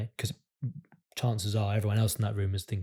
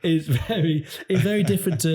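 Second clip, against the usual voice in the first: fighting giggles; 7 semitones higher, 5 dB louder than usual. Real, not acted. Trying to carry on through giggles.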